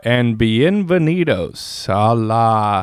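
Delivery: slow, drawn-out Spanish greeting; mock Spanish intro. Hosts opening the podcast, slow put-on Spanish. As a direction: stretch the Spanish welcome out.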